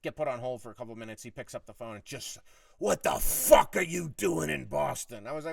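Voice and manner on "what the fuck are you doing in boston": loud, slow, higher-pitched outburst; hammered-out mock fury; retold irate boss. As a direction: imitate a boss exploding down the phone. Boss picks up phone, explodes.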